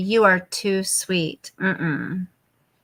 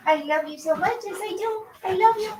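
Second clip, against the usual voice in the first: much higher cooing voice; 9 semitones higher, 3 dB quieter, faster than usual. Real, not acted. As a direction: coo love at the dog. Cooing at dog.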